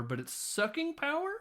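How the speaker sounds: big upward swoop on power; a mock puzzled high rise; rising question. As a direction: end high and incredulous. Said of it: "she's like".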